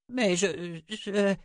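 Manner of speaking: high, hesitant, broken-off protest; stammering. A narrator's reading. Six backing away from her mother, stammering. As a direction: falter and repeat, high-pitched. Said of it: "balbutia Six en reculant".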